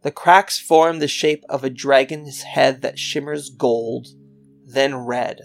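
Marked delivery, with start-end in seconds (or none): none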